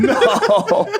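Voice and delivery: laughing no. Real, not acted. Laughing denial.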